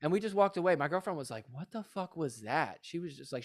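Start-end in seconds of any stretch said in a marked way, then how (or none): 1.5-2.8 soft, slow retold disbelief; disbelieving. His girlfriend after being scolded by strangers, quietly incredulous. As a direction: imitate stunned disbelief, drawn out and quiet. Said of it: "she couldn't believe it".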